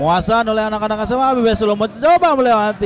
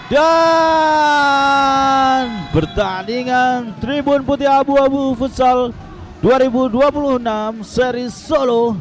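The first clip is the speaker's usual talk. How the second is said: loud, high, slow full-time call; announcer shout; 6 semitones higher, 5 dB louder than usual. Real, not acted. Announcing the final whistle.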